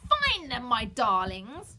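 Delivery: loud, high, dragged-out screech; witch shriek. A narrator's reading. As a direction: call to the crows like a gloating witch. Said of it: "she shrieked".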